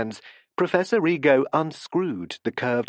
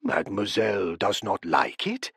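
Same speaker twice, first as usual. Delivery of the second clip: a lightly voiced puzzled professor; Professor Rigo's voice; 5 semitones lower than usual. A narrator's reading. Rigo asks softly.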